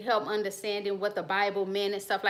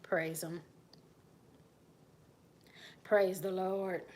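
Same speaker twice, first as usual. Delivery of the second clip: much lower, softer, curt praise; cold grudging greeting; 3 semitones lower, 8 dB quieter, slower than usual. Retold or imitated. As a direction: mutter it through dislike. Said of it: "that person they don't like".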